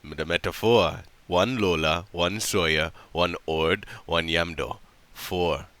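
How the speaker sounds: low, soft, measured count; Yamdo explaining. Acted. Yamdo counts his party for woman, low and deliberate. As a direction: list each one separately, low and solemn.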